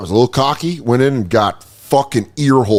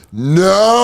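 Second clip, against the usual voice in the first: mock loud no; 8 semitones higher, 4 dB louder than usual. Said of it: "the worst where you go".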